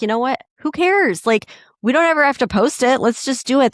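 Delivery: a bright rush of who-cares enthusiasm; excited urging. Retold or imitated. To her hesitant friend about making silly reels, excitedly urging. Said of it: "And I'm like".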